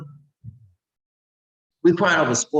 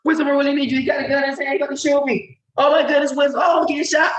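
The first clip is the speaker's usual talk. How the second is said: much higher, louder frantic plea; panicked caller; 14 semitones higher, 3 dB louder, faster than usual. Pretend. Caller pleading with wisdom at gunpoint, frantic and high. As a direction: beg like someone about to be shot.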